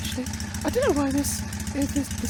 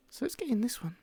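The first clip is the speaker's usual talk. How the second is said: whispering; 4 semitones lower, 9 dB quieter than usual. Real, not acted. Quiet aside while streaming.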